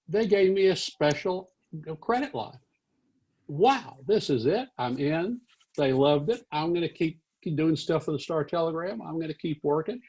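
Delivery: put-on high-pitched, thrilled young writer; elated. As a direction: relive a triumphant inner celebration. Young self celebrating his printed story.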